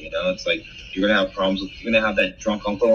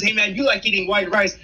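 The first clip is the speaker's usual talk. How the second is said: high, laid-back dude voice; a buddy teasing about rice, higher; casual buddy voice; 12 semitones higher than usual. Retold or imitated. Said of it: "if someone says".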